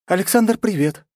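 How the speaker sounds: high, sweet little voice; tender girlish voice. A narrator's reading. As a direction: greet him in a delicate feminine voice. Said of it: "нежному голоску Марии Долгоруковой".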